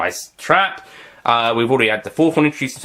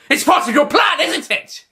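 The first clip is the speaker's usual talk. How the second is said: shrill realisation; 4 dB louder than usual. Acted. Master realising trick.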